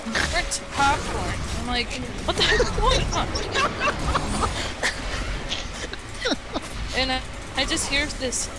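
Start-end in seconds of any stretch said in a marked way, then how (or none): 2.2-3.3 shocked outburst. Retold or imitated. Shocked by screaming and burnt popcorn. 3.3-6.9 airy high giggle at his own tale; breathless giggle between sentences; high breathless laugh. Real, not acted. Telling a silly story, breaks into a high airy giggle.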